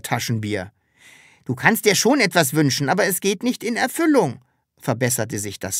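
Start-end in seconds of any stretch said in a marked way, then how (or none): 1.4-4.4 high, quick character voice; lively little creature explaining; Sams correcting itself. Acted. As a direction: correct it briskly, pitched well up.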